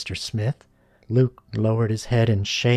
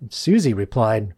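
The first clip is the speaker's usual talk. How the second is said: ordinary delivery, nothing marked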